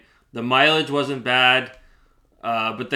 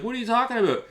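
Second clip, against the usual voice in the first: much higher, squeaky mock innocence; mocking the seller; 11 semitones higher, faster than usual. Mimicking the seller's denial, high mock innocence. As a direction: play her as clueless and shrill. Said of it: "And she's like".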